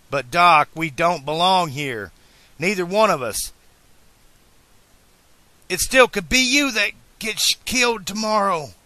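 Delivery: loud, high, insistent plea; urgent pleading. A narrator's reading. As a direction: plead with a friend to see the danger. Marty pleads with Doc to reconsider.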